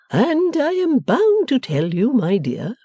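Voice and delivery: slow, high, wavering husband's voice; submissive. A narrator's reading. R W addressing his formidable wife, submissively. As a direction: offer it meekly, sliding down at the end.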